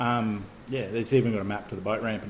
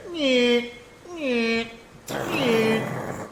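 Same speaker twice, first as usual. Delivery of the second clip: high nasal nyeh nyeh nyeh; high motor whine imitation; 12 semitones higher, much slower than usual. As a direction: mimic a whining motor in a high voice. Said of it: "it goes".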